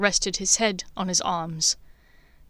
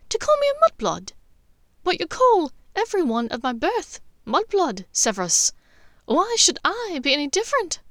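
put-on high, scornful young witch voice, faster; bitter Lily; 8 semitones higher than usual. A narrator's reading. Lily throwing the slur back at Severus, scornful and high. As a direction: throw the insult back at him.

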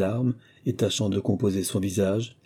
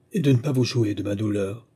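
plain reading voice

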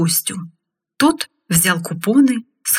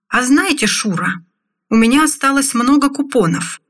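breezy, higher-pitched announcement to a friend; casual, for all to hear; 7 semitones higher than usual. A narrator's reading. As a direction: say it casually but let the whole room overhear. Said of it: "В своей непринуждённой манере, так, чтобы слышали все вокруг". (непринуждённо, чтобы слышали все вокруг)

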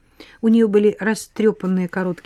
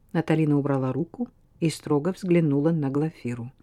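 usual speaking voice

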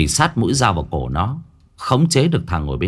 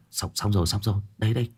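flustered; 8 dB quieter, faster than usual. Acted. Stammering terrified friend.

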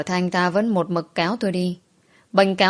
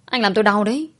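plain reading voice

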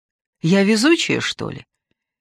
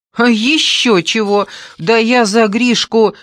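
indignant outburst; 7 semitones higher, 6 dB louder than usual. Acted. Daughter defiant, heated.

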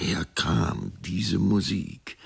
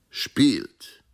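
short, higher, louder call; 6 semitones higher, 5 dB louder than usual. A narrator's reading. Dancer calls to the harpist, called out. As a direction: call out a one-word order. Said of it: "rief er dem Harfenspieler zu".

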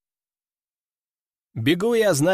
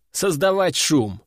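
plain reading voice

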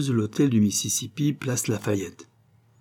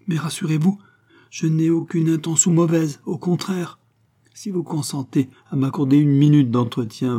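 eager, raised-pitch reassurance; higher, earnest and encouraged; emboldened; 6 semitones higher, 5 dB louder than usual. A narrator's reading. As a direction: plead your good intentions with new confidence. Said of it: "encouragé par cette mansuétude".

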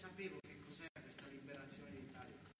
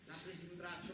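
ordinary delivery

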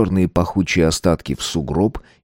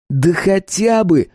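high, drawn-out exclamation; exclaiming; 11 semitones higher, slower than usual. A narrator's reading. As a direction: seize on the idea, pitched way up. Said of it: "воскликнул Стас".

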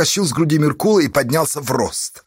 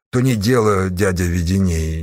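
lower, tentative objection; hesitant, cautious; 5 semitones lower than usual. A narrator's reading. Gang leader objects to the uncle, warily. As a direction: object carefully, unsure of yourself. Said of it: "неуверенно и осторожно возразил он".

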